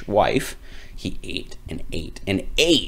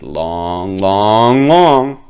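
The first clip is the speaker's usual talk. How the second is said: long long rising and swelling; stretching long; 8 dB louder, much slower than usual. A narrator's reading. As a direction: draw the words out like a growing nose. Nose stays long.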